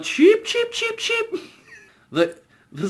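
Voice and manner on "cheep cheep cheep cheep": very high squeaky cheep cheep; chick cheeping. Retold or imitated. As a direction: peep like a little chick to mock. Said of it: "everybody starts going".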